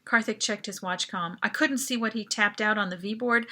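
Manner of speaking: plain reading voice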